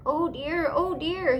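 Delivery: high, fussy, worried oh dear; Mister Pudding fussing at Auntie Claus, fretful; fretful cry. A narrator's reading. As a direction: fret like a flustered elf. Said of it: "he cried".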